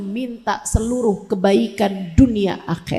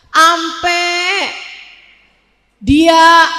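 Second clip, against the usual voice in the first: loud, pounding sermon delivery with shouted peaks; fiery preaching; 4 semitones higher, 5 dB louder than usual. Acted. Preacher warning the women of the congregation, loud fiery preaching. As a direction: hammer the point home to the congregation.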